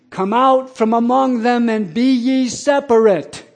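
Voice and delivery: loud, high, slow proclamation, as on the sidewalk; cried out scripture. Retold or imitated. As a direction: call the verse out to the crowd, stretched and ringing. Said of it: "And I cried out".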